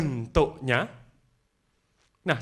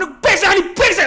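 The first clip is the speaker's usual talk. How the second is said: imitating high, loud cursing; angry customer; 9 dB louder than usual. Angry customer cursing to the staff, mock furious shout. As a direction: act out a furious man swearing. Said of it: "Orang tadi marah".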